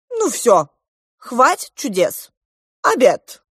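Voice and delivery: loud, high-pitched announcement in short bursts; royal command. A narrator's reading. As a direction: end the show and call everyone to the table. King claps and calls dinner.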